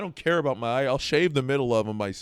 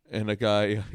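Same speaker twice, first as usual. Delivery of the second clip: ordinary talk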